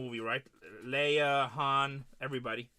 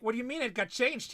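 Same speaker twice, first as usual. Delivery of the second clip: higher-pitched incredulous question; retold disbelief; 6 semitones higher than usual. Seller retelling his alarm at a delivery change, incredulous.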